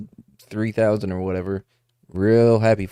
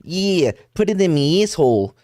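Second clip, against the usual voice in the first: higher, exaggerated Australian-sounding accent; botched Australian accent; 5 semitones higher than usual. Joking on how accents drifted from British, comes out Australian. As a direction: aim for Boston, land in Australian. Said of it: "No, wait, that's Australian".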